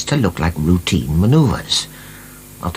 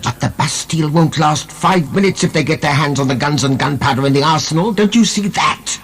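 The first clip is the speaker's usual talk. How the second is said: higher, urgent retort; exasperated; 9 semitones higher than usual. Acted. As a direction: fire it back, frustrated. Heated argument about the gunpowder.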